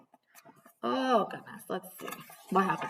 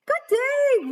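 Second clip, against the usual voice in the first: a much higher, louder mock growl; 5 dB louder than usual. Wolf greeting Little Red in the woods, mock growl. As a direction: ham up the wolf's greeting. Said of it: "growled the wolf".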